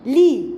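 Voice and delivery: the letter sound li. Shown as a demonstration. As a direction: kasra sound li. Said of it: "لام كسرة لي".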